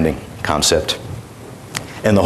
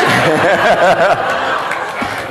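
amused laugh; 9 semitones higher than usual. Real, not acted. Laughs at audience correction.

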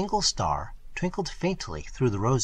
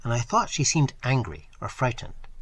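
ordinary delivery

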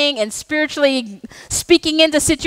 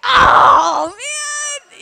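disgusted exclamation; 9 semitones higher than usual. Retold or imitated. Pastor mock-recoiling at husband's fart, disgusted cry. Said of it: "I'm just like".

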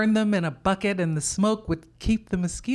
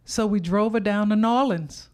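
ordinary delivery, nothing marked

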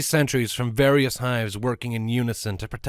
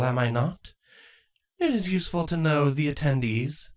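higher, sly scheming queen; 7 semitones higher than usual. Acted. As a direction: tease her with a sly question. Crudalis voice.

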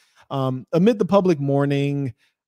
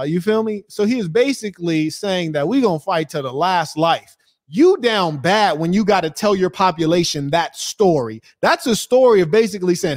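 ordinary delivery

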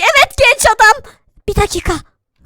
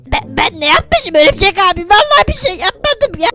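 normal talking voice

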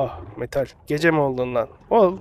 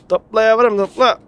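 high, loud coaxing cheer; eager urging; 7 semitones higher, 6 dB louder than usual. Real, not acted. Gamer cheering his collector on, loud eager urging. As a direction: egg it on like a pet.

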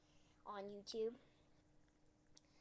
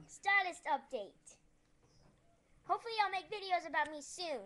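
ordinary delivery

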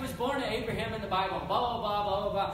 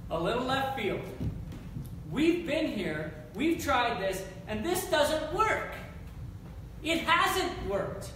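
higher, louder, slow exasperation; frustrated Abraham; 6 semitones higher, 4 dB louder, slower than usual. Acted. Abraham venting frustration at God, louder and higher. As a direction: plead it, fed up and worn out. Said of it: "I can hear his frustration".

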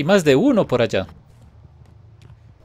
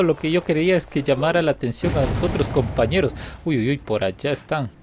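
usual speaking voice

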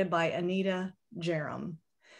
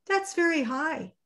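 high, impressed little thought; little hare awed, pitched up; 10 semitones higher than usual. Acted. Little hare thinking to himself, high awed voice. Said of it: "thought Little Nut Brown Hare".

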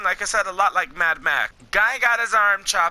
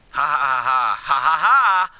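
loud, staged "ha ha ha"; mock villain laugh; 6 semitones lower, 3 dB louder than usual. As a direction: laugh like a taunting villain, each ha punched. Acted villain laugh in game dialogue.

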